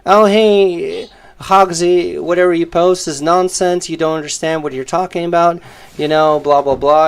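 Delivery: mild mock of a smug rival; mocking imitation. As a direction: parrot the rival as a know-it-all. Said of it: "whenever you said, like, as if, like".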